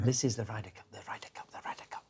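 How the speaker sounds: soft repeated whisper, fading; whispering Seve again. Retold or imitated. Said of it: "I can't do an accent".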